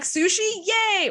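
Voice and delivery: high happy squeal of joy; excited cheer. Retold or imitated. Grown-up friend cheering over sushi, excited. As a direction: cheer like you just found treasure.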